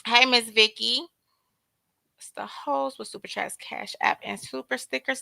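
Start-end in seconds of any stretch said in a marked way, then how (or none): 2.2-5.2 quick whispered aside; soft unvoiced shout-out list. Real, not acted. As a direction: whisper the thanks under your breath. Reads supporters list aside.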